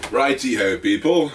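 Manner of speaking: high, loud and bouncy hello; upbeat greeting. Real, not acted. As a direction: call out to the viewers with energy. Energetic greeting to viewers.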